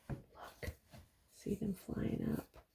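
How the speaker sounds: very soft unvoiced whisper. Real, not acted. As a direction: breathe it barely aloud. Hushed pointing at moths.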